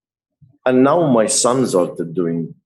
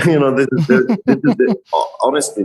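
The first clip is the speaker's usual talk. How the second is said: talking through giggles, higher; laughing; 5 semitones higher than usual. Real, not acted. Artist explaining first tattoos to interviewer, laughing. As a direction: laugh while trying to explain. Laughs at own virginity comparison.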